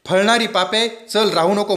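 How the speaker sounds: high, insistent urging; lightly voiced call, pitched high; urging voice of fate. A narrator's reading. Sins urge Bhau not to stay, high urgent voice.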